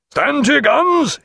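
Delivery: high shouted battle order; cry out. A narrator's reading. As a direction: cry it to the whole gun deck. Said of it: "he cried".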